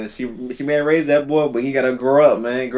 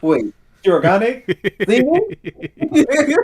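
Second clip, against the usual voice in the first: higher, slowed dawning horror, played for laughs; mock traumatised disbelief; 10 semitones higher, much slower than usual. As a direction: climb to a high, laughing question. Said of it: "like, P T S D flashbacks".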